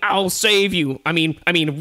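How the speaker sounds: loud, high mock-heroic cry; heroic lion. Lion rushing to save tiger, loud heroic cry. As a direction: call out like a rescuer. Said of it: "And then lion's like".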